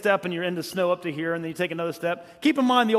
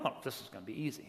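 much softer, fast, under the breath; inner thought; 8 semitones lower, 16 dB quieter, much faster than usual. Retold or imitated. Speaker quietly thinking the hike will be easy. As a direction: toss it off as a private smug thought. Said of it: "So I'm thinking".

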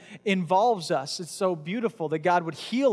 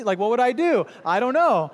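exasperated; 4 semitones higher, 6 dB louder than usual. Acted. Imagines being the let-down father.

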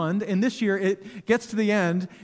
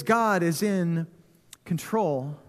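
slow, weighty; 2 semitones lower, much slower than usual. Acted. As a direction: let each word land slowly, then sink. Sermon theme line dwelt on.

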